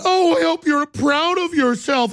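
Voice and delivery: high, mocking voice; mocking whine. Mocking the couple scolding him, high put-on whine. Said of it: "They went".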